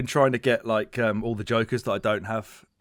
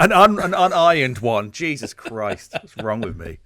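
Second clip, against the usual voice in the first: mock horror; 2 semitones higher, 8 dB louder, slower than usual. Real, not acted. Mock shame at own shirt.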